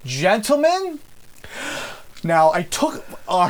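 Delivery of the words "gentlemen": high theatrical address to the room; stage delivery of a line. Acted. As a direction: open a grand speech, pitched up. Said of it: "I stand up from the table".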